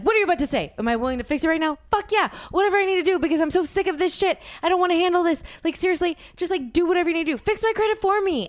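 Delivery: high, rapid, insistent outburst; worked-up, pleading rush; desperate eagerness. Retold or imitated. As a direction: blurt it out high and fast, fed up. Acting out their desperate eagerness to fix credit.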